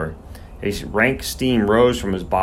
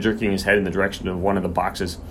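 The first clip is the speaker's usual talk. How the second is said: ordinary delivery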